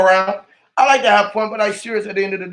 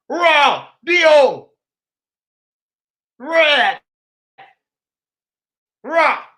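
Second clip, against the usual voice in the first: shouted spelling; 7 semitones higher, much slower than usual. Real, not acted. Ranting to his viewers about radiant energy, shouted and slow. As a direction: belt out each word like a chant.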